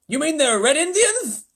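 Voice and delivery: high, loud blustering; excitable President. Acted. The President to astronaut Showler, high and loud. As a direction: jump to conclusions.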